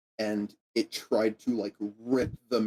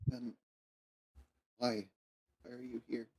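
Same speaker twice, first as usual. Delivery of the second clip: timid quiet; 11 dB quieter than usual. Acted. GM voices timid kid.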